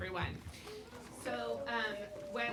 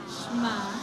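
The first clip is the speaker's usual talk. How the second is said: loud group call; 9 dB louder than usual. Shown as a demonstration. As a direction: say it out loud together. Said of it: "can I hear you say that word?".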